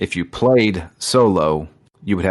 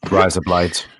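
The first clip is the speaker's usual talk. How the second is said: "Rise up, lights" in one quick run; quick, run-together "Rise up, lights"; said fast; 2 semitones lower than usual. Shown as a demonstration. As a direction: blend "Rise up, lights" into a single phrase. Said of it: "you have to say it fast".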